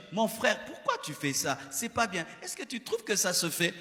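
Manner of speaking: soft, calm brotherly correction; gentle private rebuke. Shown as a demonstration. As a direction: quietly reprove a brother. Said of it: "Ici, je m'adresse à la foule. Mais quand je m'adresse à toi".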